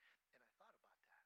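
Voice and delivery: fast whispered aside. Real, not acted. Preacher confiding to congregation, quick whisper. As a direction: drop to a quick breathy whisper. Aside after mocking quote.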